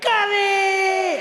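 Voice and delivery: high, loud drawn-out scream. Retold or imitated. Boy opening his lunch, loud high scream. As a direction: howl the word in despair. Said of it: "he'd be like".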